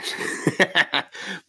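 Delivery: high soft laugh at himself; a breathy chuckle after the rant. Real, not acted. Laughing off his own ranting.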